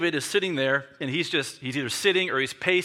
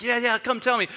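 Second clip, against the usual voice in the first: higher, impatient urging; eager; 7 semitones higher than usual. Acted. King urges the runner to report, eager. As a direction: urge the messenger on eagerly.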